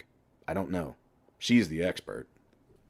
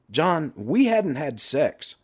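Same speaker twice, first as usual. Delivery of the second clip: drawn out slowly, higher; slow confession; 8 semitones higher, much slower than usual. Acted. Toby admits his marriage trouble, slowly. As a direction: let the admission drag out.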